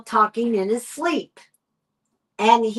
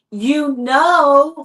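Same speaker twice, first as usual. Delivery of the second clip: a louder, higher retelling of his shout; mock sleep shout; 4 semitones higher, 5 dB louder than usual. Wife imitates her husband shouting in his sleep, mock shout. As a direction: call it out like a man yelling in his sleep. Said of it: "and he's shouting".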